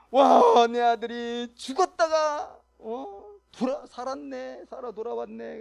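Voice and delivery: a loud, high, slow cry of joy at the son's return; overjoyed father. Retold or imitated. Father embracing his lost son, crying out in joyful amazement.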